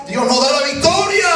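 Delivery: high, loud cry of victory; acted triumphant announcement. Announcing victory to the rejoicing people, acted triumphant cry. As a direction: call it out high to a crowd.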